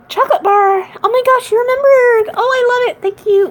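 high, gasping, thrilled; delighted surprise. Acted. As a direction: gasp and squeal with delight. Surprised by a gift.

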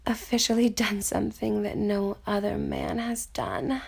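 breathy afterglow whisper. Acted. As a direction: whisper it close and soft.

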